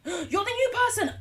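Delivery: high, loud burst of delight; gasp, then cry it out; excited exclamation. Real, not acted. Streamer spotting the new person, excited.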